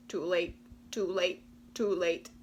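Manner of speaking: croaking chorus. Acted. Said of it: "chorused the frogs".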